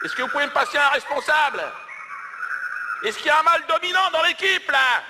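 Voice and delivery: high, loud call over a noisy line; calling for a manager. Acted. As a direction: shout to be heard above the din. Shouting over a crowd on phone.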